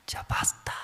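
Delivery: whispered "Ya basta", barely breathed out; inner whisper. Retold or imitated. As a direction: say "Ya basta" only to yourself, in a whisper. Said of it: "sí, adentro".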